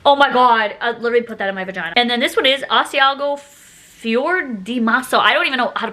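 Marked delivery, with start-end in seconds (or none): none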